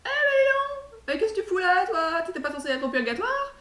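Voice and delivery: high, loud indignant outburst; indignant Saint Peter. Acted. Saint Peter catching the monk at heaven's door, loud and indignant. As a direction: scold the intruder at the gate. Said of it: "Saint-Pierre qui arrive, il fait".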